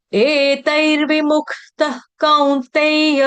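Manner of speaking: slow, sung-out Sanskrit verse; verse recitation. Shown as a demonstration. Teacher chanting the Gita verse for students, slow. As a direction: intone the verse slowly and clearly.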